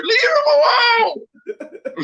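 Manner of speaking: hammed-up nosy neighbor crying out; shrill neighbor. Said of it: "they come out there".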